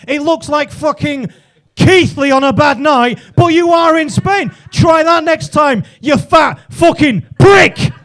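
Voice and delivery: high-pitched tirade building to a shout; indignant rant. Acted. Telling off man who assumed he was Spanish, angry shouted rant. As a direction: tear into him, slowing on the final insult.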